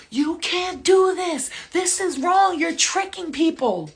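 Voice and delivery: high, loud, drawn-out protest scream. Retold or imitated. Dreamer confronting the man in the portal, screaming. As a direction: scream the accusation, stretching the words. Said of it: "I'm screaming at him, screaming".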